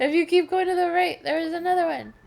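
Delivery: insistent. Real, not acted. Urging partner on.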